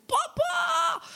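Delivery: very high, desperate shout; screaming for papa. Retold or imitated. Girl stranded on a lake screams to her father, desperate high yell. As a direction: yell across the water for your father. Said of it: "En ik riep".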